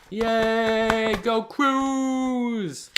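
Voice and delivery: cheering. Acted. Cheering at the story's climax.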